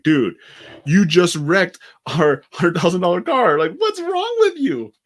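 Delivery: high, loud, slow furious rant; angry blowup. Acted. Car owner yelling at the driver who wrecked it, loud and high. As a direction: let him have it, voice climbing high.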